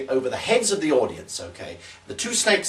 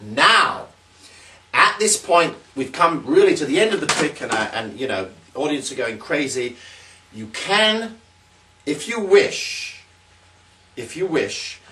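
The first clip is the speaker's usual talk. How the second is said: ordinary talk, nothing marked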